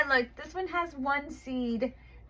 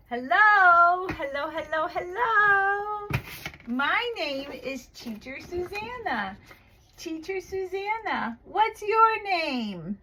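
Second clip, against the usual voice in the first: put-on high, bright, sing-song greeting to a small child; bright teacher voice; 6 semitones higher, 3 dB louder, slower than usual. Online teacher greeting her young student, high and sing-song.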